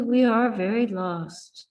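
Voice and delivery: ordinary delivery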